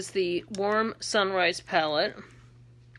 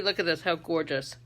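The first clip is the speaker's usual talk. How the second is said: usual speaking voice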